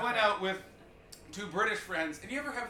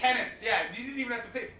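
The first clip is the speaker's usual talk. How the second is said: normal talking voice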